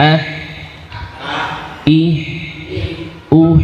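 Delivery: "Ah", "Ih", "Uh" ending in breath; sukun ha drill. Shown as a demonstration. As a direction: end each on a breathy h. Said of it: "Kita sukun". (ha sukun dengan hamas)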